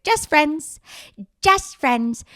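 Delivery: a hammed-up awkward repeat; mock nervous tick. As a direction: blurt it like a nervous tic. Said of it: "It was just a nervous fucking tick".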